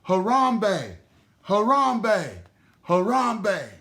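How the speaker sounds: loud joyful chant, high and ringing; shouted. A narrator's reading. Animals cheering together at the feast, shouting loud. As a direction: shout it together three times, celebrating. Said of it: "Everyone laughed and shouted".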